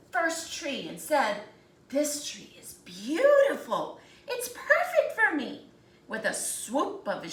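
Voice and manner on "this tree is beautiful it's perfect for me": much higher and louder, with a laugh; delighted woodcutter. Acted. Woodcutter admiring the first tree, loud delighted laugh. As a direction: crow over a lucky find.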